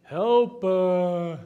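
hammed-up whining plea; whiny prayer. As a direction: whine a prayer like a teenager. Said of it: "That's how my prayers sound".

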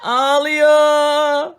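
high, loud, drawn-out wailing sympathy; excited mock pity. Real, not acted. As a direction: make a big fuss over the crying doll. Doll crying, father exclaims over it. (heyecanlı, abartılı acıma)

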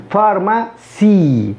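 فارماسي said slowly, sound by sound; slow careful pharmacy. Shown as a demonstration. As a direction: spell out فارماسي slowly, syllable by syllable. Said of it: "فاء ثم ألف ثم راء فأصبحت فار". (نطق فارماسي ببطء ووضوح)

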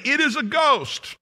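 high-pitched frightened exclamation; fearful cry. Acted. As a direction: cry it out in alarm, acted. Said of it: "they cried out for fear".

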